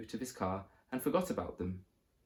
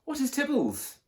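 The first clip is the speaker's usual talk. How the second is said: very high, shrill-pitched squeaked question; landlady squeaking, demanding to know what Tibbles is; 5 dB louder than usual. A narrator's reading. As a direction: squeak it out in alarm. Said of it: "squeaked Missus Featherstone".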